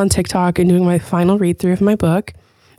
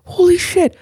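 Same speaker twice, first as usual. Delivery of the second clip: shocked outburst; 9 semitones higher than usual. Retold or imitated. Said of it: "I'm like".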